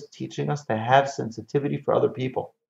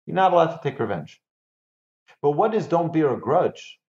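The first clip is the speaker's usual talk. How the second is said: usual speaking voice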